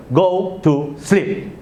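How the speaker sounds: very slow, stern parental order. Shown as a demonstration. Parent tells refusing child the second time, slow and stern. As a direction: draw out each word sternly. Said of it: "a bit slower and stern".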